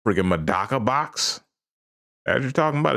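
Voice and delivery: ordinary delivery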